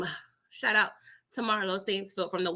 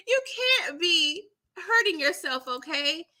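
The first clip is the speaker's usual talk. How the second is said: laughing; 7 semitones higher than usual. Real, not acted. Laughing plea.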